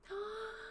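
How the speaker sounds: sharp high gasp of surprise; excited gasp. Real, not acted. Streamer gasping at a fan's job news in chat.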